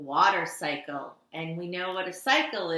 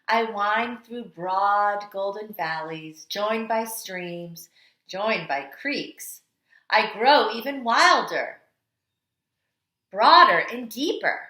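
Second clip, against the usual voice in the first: storytelling read-aloud; 3 dB louder than usual. Acted. Teacher reading The Water Dance to kindergarteners, dramatic storytelling. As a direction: read it like the water itself is dancing. Reads picture book aloud.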